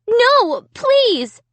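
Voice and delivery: high, loud desperate begging; frightened pleading. A narrator's reading. Amy begging the dummy, high and scared. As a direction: plead in panic.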